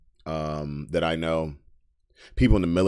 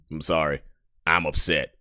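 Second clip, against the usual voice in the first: ordinary delivery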